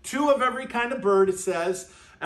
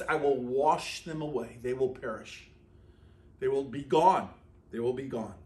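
solemn voice of God; 8 semitones lower, 5 dB quieter than usual. Acted. God's words read aloud.

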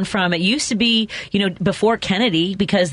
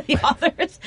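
laughing; 9 semitones higher, slower than usual. Real, not acted. Caller's question makes host laugh.

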